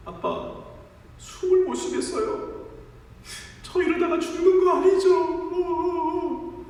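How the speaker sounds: put-on high, whimpering child voice; frightened child. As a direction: plead like a scared little boy. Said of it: "아이가 이야기하는 겁니다". (겁먹은 아이처럼 높게 칭얼대며)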